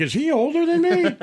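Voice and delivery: high, alarmed mock disbelief; shocked question. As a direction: squeak it out in disbelief. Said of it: "I'm like".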